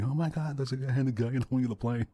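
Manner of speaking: mock scream. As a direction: imitate a panicked passenger's scream, without full volume. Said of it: "he's, like, screaming".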